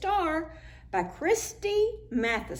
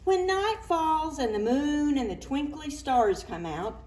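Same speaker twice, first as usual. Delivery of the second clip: ordinary delivery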